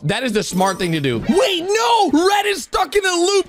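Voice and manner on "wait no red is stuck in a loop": high, urgent exclamation; high-pitched, excited alarm; alarmed realisation. Real, not acted. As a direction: call out a shocking discovery. Realises Red is looping.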